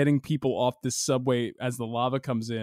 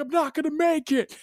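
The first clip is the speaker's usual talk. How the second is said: panicked; 13 semitones higher than usual. Retold or imitated. Doomed hero, retold.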